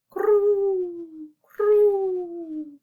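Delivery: high, long-held coos; cooing doves. A narrator's reading. Reader recapping the doves' noise, cooing. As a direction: coo high and drawn out. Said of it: "The doves cooed".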